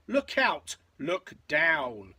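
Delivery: warning call, high then falling; mild yell. A narrator's reading. Drip warns his sisters on the bridge, mild yell. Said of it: "who yelled".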